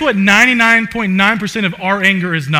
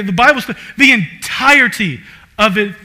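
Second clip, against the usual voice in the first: ordinary delivery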